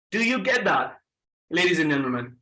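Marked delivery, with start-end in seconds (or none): none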